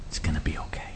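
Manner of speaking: soft unvoiced whisper of comfort; tender whisper. God whispering comfort to a frightened child, whispered. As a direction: breathe it like soothing a frightened child.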